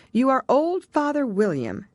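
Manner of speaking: a hint of a child reciting; Alice reciting. A narrator's reading. Quoted poem title.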